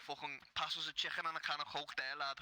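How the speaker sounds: thick Scouse, CHICKEN with a throaty ch; Scouse accent. Shown as a demonstration. Local shows American how Liverpool asks for food, Scouse accent. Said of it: "The way you would say it here".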